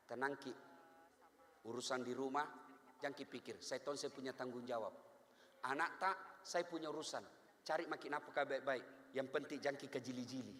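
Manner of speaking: low, soft reassuring wife; soothing wife. Retold or imitated. Good wife soothing her worried husband, soft and low.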